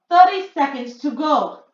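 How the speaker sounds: crying out. A narrator's reading. Grand High Witch calling the countdown to the witches. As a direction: call the time out gleefully. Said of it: "cried the Grand High Witch".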